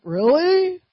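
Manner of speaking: mock the team's shock; imitated disbelief. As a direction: team questioning his odd plan in surprise. Team's incredulous reaction.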